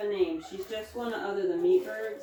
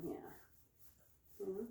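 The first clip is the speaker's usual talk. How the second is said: ordinary talk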